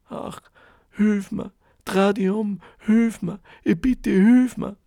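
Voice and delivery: a trapped voice begging for help; pleading wail. A narrator's reading. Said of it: "das Klagen wird immer lauter". (klagend flehend, lauter werdend)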